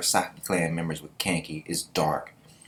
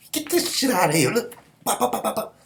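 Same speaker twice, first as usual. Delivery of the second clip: much higher mock fighting talk; hammed-up, pitched-up brawler bravado; pretend fighting back; 13 semitones higher than usual. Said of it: "I'm about to be like".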